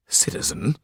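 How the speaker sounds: breathless whispered echo of Citizen; disbelieving whisper. Acted. Marquis stunned at being called citizen, whispering. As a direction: mouth the word in shock.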